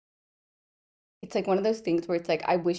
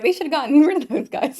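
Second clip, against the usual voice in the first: high, loud, laughing through the words; giggly imitation of complainers; laughing mock quote; 9 semitones higher, 6 dB louder than usual. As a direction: barely get the joke out for laughing. Jokes about imagined readers, laughing.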